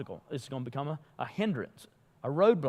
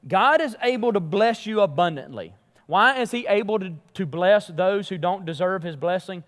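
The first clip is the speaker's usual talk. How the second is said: preaching at full force; 4 semitones higher, 6 dB louder than usual. Acted. Preaching climax on grace.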